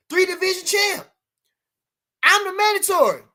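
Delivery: high and loud insistence; indignant outburst. Real, not acted. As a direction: protest it indignantly. Insisting on mandatory status.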